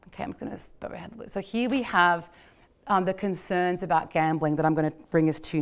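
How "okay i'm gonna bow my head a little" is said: quick, hushed whisper to herself; whispered aside. Real, not acted. Lecturer muttering to herself mid-lecture, whispered quickly. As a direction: toss it off under your breath, fast.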